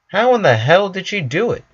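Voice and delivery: usual speaking voice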